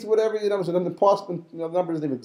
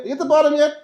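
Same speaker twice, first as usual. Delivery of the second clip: calling down; 9 semitones higher, 5 dB louder, faster than usual. Retold or imitated. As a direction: shout it down into the well. Brothers calling down the pit.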